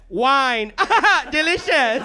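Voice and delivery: awkward small talk. Retold or imitated. Fiance mimicking his awkward talk with an attractive lady, mock-weird enthusiasm. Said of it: "that's not how you talk to people".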